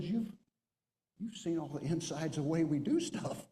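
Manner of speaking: high, imitated dismay; dismayed realization. Enlisted man realizing an officer saw everything, dismayed. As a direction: act out the horror of having been found out.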